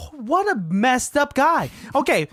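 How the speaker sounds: usual speaking voice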